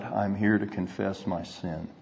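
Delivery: a soft, muttered retelling of her confession. Retold or imitated. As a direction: quietly imitate her mumbling it out. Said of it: "muttered out".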